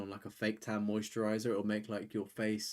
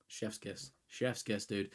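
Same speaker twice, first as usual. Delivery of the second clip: ordinary talk, nothing marked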